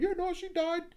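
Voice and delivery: high, airy mocking voice of the oblivious guy; mock dude answering. Friend mocking a liar about his sister in a high, careless voice. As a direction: toss it off like he's clueless. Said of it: "he'd probably".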